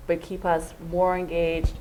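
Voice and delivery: normal talking voice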